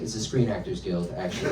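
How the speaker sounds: low, quiet matter-of-fact reply; understated correction. Acted. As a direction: drop it low and soft as a dry correction. Acted deadpan correction in a bit.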